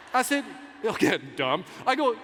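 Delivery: normal talking voice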